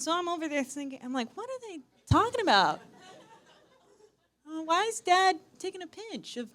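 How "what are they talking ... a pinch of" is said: high-pitched puzzled voice; mock bewilderment. Daughter watching dad with security, high and baffled. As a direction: wonder aloud in a high, baffled voice.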